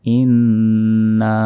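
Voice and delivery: ইন্না very slow with the nn held; ghunna on inna. Shown as a demonstration. Teacher repeating ইন্না with ghunna. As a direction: stretch the nasal nn in ইন্না. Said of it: "ইন গুণনা হবে".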